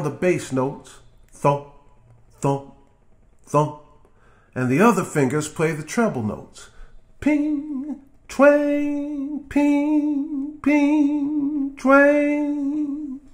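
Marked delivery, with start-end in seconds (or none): 1.3-3.8 thump out the bass notes; bass thump sounds. Shown as a demonstration. 7.2-13.2 high sung pings and twangs; sung guitar sounds. Shown as a demonstration. Imitates guitar treble.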